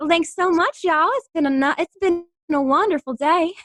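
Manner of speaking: put-on high, sweet Southern charmer accent; Southern twang. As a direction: sign off a call with high-pitched Southern sweetness. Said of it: "A little Southern twang".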